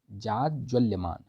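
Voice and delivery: "जाज्वल्यमान" धीरे, हर अक्षर साफ़; "जाज्वल्यमान" को धीमे तोड़कर पढ़ाओ; slow spelled-out word. Shown as a demonstration. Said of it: "जाज्वल्यमान होता है". ("जाज्वल्यमान" धीमा, स्पष्ट उच्चारण)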